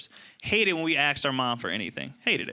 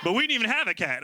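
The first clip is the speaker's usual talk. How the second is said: ordinary talk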